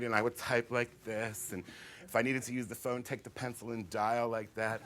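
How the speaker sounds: ordinary talk, nothing marked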